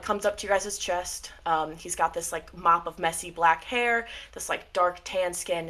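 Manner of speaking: ordinary delivery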